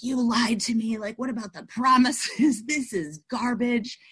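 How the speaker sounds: hammed-up outrage at her sponsor; mock indignant. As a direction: whine it like a betrayed kid. Angrily confronting sponsor Celeste.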